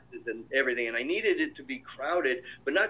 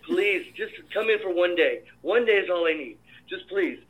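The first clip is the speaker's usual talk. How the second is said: higher-pitched, insistent mock pleading; retold begging; 4 semitones higher than usual. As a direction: act out begging an actor to say yes. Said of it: "I'm begging you".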